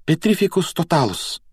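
high-pitched spell casting; girl's exclaimed spell; exclaiming. A narrator's reading. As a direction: call out the incantation high. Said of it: "воскликнула она".

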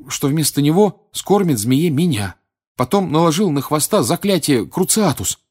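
put-on high, loud, urgent boy's voice; worked-up retelling, pitched high, easing at the end; agitated account. A narrator's reading. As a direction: pour out the nightmare urgently. Harry anxiously recounts his nightmare.

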